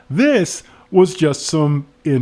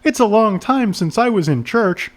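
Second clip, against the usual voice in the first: slightly affected higher voice; lightly voiced woman; 6 semitones higher than usual. Woman answering the cop, lightly voiced higher. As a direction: pitch it up a little for her. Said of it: "She shrugged".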